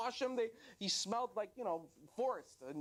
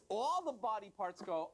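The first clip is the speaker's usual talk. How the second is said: ordinary talk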